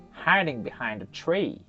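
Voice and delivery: ordinary delivery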